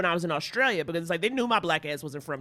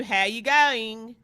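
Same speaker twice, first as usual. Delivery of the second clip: slow demo of an Australian accent; much slower than usual. Shown as a demonstration. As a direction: show off your one Australian phrase. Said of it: "I can do".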